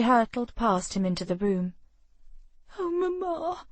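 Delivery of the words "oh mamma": tearful, pitched up and soft; a hint of a weeping girl; watery reply. A narrator's reading. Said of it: "came the watery reply".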